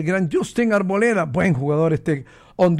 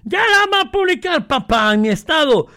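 high, stretched-out excited call; put-on high hollering voice; exclaimed mock call; 9 semitones higher, 4 dB louder than usual. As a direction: call it out high and loud, hammed-up. Mock excited shout to his boss's photo.

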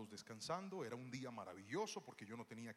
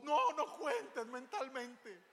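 put-on child protesting; 11 semitones higher, 9 dB louder, much slower than usual. Daughter protesting dad's counting.

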